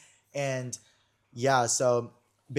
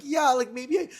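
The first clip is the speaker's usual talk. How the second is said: high and louder, playfully overdone; high put-on voice, laughing at herself; mock confession; 6 semitones higher, 6 dB louder than usual. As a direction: blurt the admission way up high in a put-on voice. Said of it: "I'm like".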